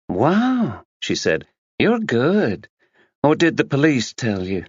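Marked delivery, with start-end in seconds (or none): 0.1-0.8 mock-impressed wow. A narrator's reading. Tina impressed by guess. 1.8-4.6 higher American socialite voice; American accent. A narrator's reading. Tina answering Max in a breezy American accent.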